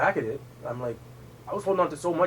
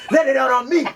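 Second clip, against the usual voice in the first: higher, louder imitated challenge; goading brother; 10 semitones higher, 7 dB louder than usual. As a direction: dare him to take it out on you. Acts out brother goading him.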